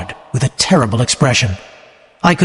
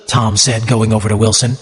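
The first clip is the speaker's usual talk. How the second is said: usual speaking voice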